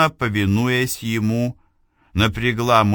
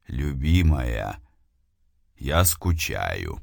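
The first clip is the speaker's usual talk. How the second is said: low, slow distant lover; low beloved voice; 7 semitones lower, 4 dB quieter, slower than usual. Acted. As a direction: speak low and slow like a spirit. Голос возлюбленного сущности. (низкий голос возлюбленного)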